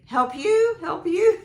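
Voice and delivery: put-on high, loud little-girl plea; imitating toddler. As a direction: mimic a toddler asking for help, rising at the end. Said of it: "she would say".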